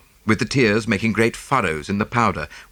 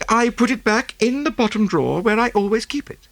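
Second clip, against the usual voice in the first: high, breathless socialite voice; gushing rich American lady; 13 semitones higher than usual. Acted. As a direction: pour it out like a fussy rich lady. Mrs Oppleson voiced.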